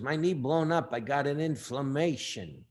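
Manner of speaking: slow New York tough-guy drawl; Cha Cha. Acted. Manager complaining about his knee, slow New York accent. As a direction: drag the words out like a New York boxing manager. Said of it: "That's how he talked".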